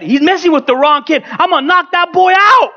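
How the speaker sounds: high-pitched, reenacted furious outburst; upset rant. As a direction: rant like a father at his limit. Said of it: "I finally got upset".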